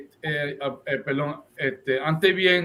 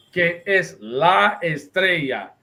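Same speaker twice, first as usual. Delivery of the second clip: estrella drawn out slowly and emphatically; slow the phrase right down and lean on "estrella"; stress on estrella; 3 semitones higher, much slower than usual. Real, not acted. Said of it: "I'm emphasizing the word estrella".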